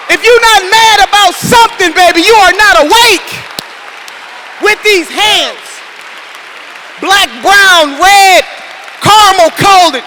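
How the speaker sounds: loud, high fiery preaching; rally the room and build the fire; preaching at full force. Acted. Rally speaker firing up the crowd, preaching at full force.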